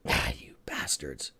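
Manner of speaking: low slow whispered bastards; muttered curse. Real, not acted. Trader whispers curses at his bank app. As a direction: grumble it under your breath.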